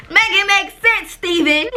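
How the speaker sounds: high, loud exasperated plea; exasperated outburst. Real, not acted. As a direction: throw it out loud and high, fed up. Exasperated at dad's illogical claim.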